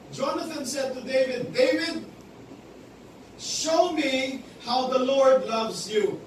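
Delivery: loud, slow preaching plea; full-force preaching. Acted. Preacher pressing the congregation with Jonathan's plea, loud. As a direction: preach it out, climbing to a loud call.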